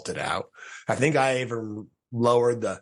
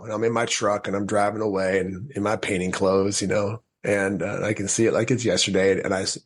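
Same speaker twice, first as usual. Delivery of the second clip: ordinary talk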